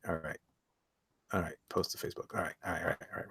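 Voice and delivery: normal talking voice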